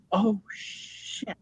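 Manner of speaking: whispered aside of sinking dread; retold realization, under the breath; hushed dread. Clown realizes silent police may shoot, hushed dread.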